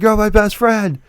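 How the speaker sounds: high-pitched imitation of a devoted pal; mock gushing. Film buddy declaring friendship to his pal, mock gushing.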